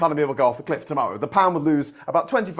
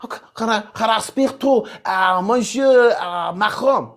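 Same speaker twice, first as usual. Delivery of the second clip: higher put-on French accent, slow on Monsieur; hammed-up French accent asking for Macron; bad French accent English to get through; mock French accent; 4 semitones higher than usual. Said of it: "in a, in a French accent".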